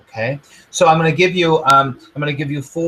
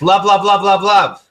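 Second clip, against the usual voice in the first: clipped, louder, higher love mantra; angry love; 6 semitones higher, 7 dB louder than usual. Shown as a demonstration. Coach acting an angry listener forcing love, louder. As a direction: spit out love through anger. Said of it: "you might say it like this".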